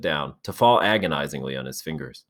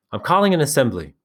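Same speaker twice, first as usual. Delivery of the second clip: raised call to the group; 8 semitones higher, 5 dB louder, faster than usual. Acted. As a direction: call it out firmly. Ralph calls the assembly.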